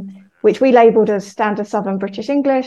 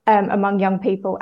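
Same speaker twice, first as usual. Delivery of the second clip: normal talking voice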